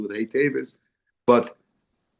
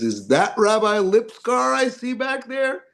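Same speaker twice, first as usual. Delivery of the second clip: put-on elderly judge voice; 11 semitones higher than usual. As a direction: call out across the courtroom like an old judge. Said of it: "He says".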